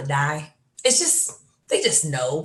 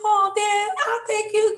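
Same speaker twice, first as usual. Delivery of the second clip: put-on much higher, sweet little-girl thank you; high girlish voice. Daughter thanking her dad on the phone, high and girlish.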